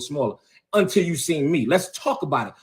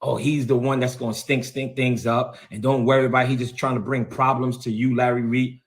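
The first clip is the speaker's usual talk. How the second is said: lower, mocking imitation of Jordan; 6 semitones lower than usual. Mocking the archbishop's mentee who called him a skunk, lower voice. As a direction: put on a lower voice to mock what Jordan said about him. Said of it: "called me a skunk".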